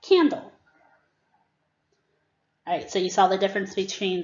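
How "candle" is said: higher, loud quick Candle; student blends word. Shown as a demonstration. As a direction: say Candle fast and bright like a pupil. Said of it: "And the student says".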